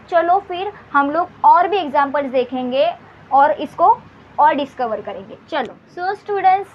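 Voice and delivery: ordinary delivery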